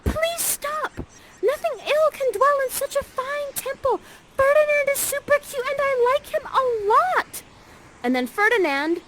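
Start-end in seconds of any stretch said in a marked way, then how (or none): 0.0-7.4 put-on much higher, girlish plea; pleading young Miranda. Miranda begging her mother to stop the fight, high girlish plea. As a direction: squeal it like a lovestruck teen daughter. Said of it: "And Miranda says this".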